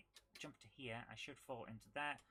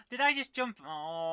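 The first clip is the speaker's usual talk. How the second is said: startled dismay; 3 semitones higher, 13 dB louder than usual. Real, not acted. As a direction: cry out as you see your own blunder. Jumps into a flame by mistake.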